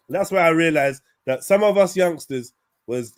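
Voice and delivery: ordinary delivery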